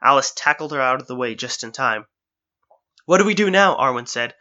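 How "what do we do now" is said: high, loud shout over the roaring. Acted. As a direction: call it out above the noise. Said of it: "She had to shout".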